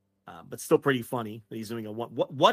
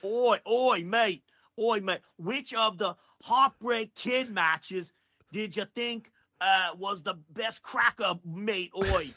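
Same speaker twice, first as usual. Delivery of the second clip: hammed-up Aussie, higher, slow and drawn out; over-the-top Australian bloke with oi and crikey; mock Australian accent; 6 semitones higher, slower than usual. Host asks a wrestler questions in comic Australian accent. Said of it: "nineteen ninety comedian level Australia accent".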